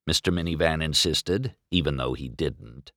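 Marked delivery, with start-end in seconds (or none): none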